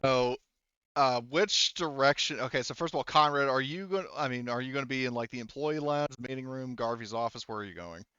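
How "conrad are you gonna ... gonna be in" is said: ordinary talk, nothing marked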